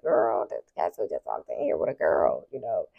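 low, soft gossip to a friend; hushed aside. Real, not acted. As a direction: keep it down, don't be overheard. Whispering to friend on phone.

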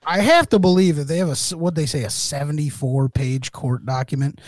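ordinary talk

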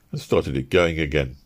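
usual speaking voice

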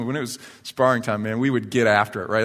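ordinary talk

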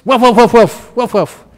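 loud, high imitated woofs; barking dogs. Monk imitating Bali dogs barking at him on the way to breakfast, loud high woofing. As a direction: bark it out like a street dog.

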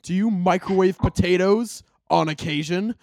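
loud, emphatic questioning; mock interrogation. Grilling a friend about microwaved potatoes, loud mock interrogation. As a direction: demand an answer at high volume.

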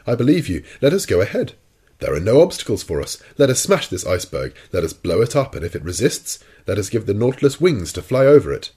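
loud mock-eager urging; rally him with exaggerated, ironic zeal; ironical enthusiasm. A narrator's reading. Professor mockingly cheers the captain on, ironical. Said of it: "in a slightly ironical tone".

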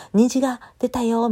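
bright-eyed little announcement; cheery. Shown as a demonstration. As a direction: sing it out like you just spotted a rainbow. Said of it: "みたいな感じで元気でしょ".

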